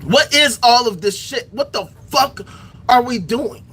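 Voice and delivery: usual speaking voice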